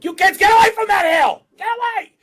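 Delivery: very high, loud mock yell at the kids; hammed-up high shout at kids; parent yelling. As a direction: scream like a freaked-out parent. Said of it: "I'd be like".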